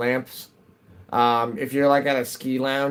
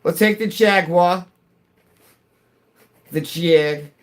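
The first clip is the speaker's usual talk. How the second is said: higher, louder mock British voice; a touch of British swagger; British accent; 6 semitones higher, 4 dB louder, slower than usual. Streamer riffing on British cars, mock British accent. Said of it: "now immediately I'm gonna go into Austin Powers".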